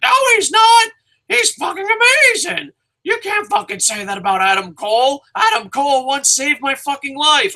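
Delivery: high, loud, indignant fan yelling; mock fan outrage. Mimicking fans who yell back at his criticism, loud and high. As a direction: shout it like an outraged superfan.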